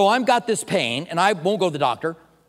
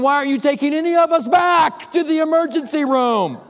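exasperated plea; 10 semitones higher, 7 dB louder than usual. Retold or imitated. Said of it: "And I'm wondering".

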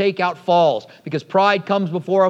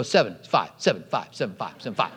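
low, hushed tit-for-tat; muted bickering; 10 semitones lower, 7 dB quieter, faster than usual. Retold or imitated. Preacher replaying a dispute with a friend, low and hushed. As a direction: trade the numbers back and forth under your breath. Retold back-and-forth argument over a number.

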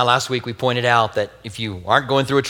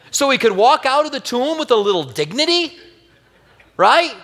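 amused; 12 semitones higher, 4 dB louder than usual. Real, not acted. Amused mocking aside.

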